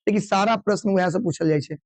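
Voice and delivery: ordinary delivery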